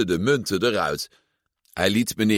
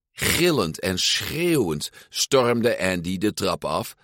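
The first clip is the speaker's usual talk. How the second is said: usual speaking voice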